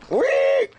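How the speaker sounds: very high, loud pig squeal. Acted. Streamer playing the squealing pig for chat, shrill squeal. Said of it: "Squeal like a pig".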